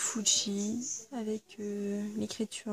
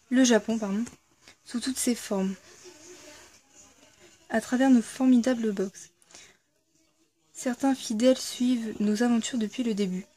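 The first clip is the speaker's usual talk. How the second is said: lecture de la carte; 5 dB louder than usual. Acted. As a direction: lecture à voix haute, un peu plus fort. Reads the founders' card aloud. (lecture à voix haute d'une carte de remerciement)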